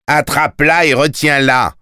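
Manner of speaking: high, forceful shouted order; thundering command. A narrator's reading. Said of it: "tonna le collecteur, si fort que Tim".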